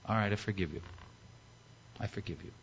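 low, hushed pardon; gentle forgiveness. Acted. King in the throne room pardoning his servant, gently.

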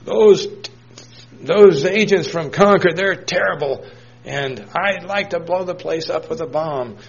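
put-on high, loud elderly rant; grumpy old man. Elderly man complaining to state interviewer, high cranky rant. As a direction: play the cranky old man railing at Concord. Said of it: "He said".

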